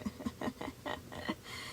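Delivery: soft, voiceless dry chuckle; dry laugh. A narrator's reading. Moon looms over the boy with a quiet, dry laugh. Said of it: "shuddered with a dry laugh".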